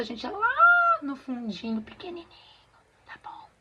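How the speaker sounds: fading to a whisper; very soft. Shown as a demonstration. Teacher shows how small the countermelody must be, fading to a whisper. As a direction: shrink the voice down to almost nothing. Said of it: "pra fazer bem baixinho".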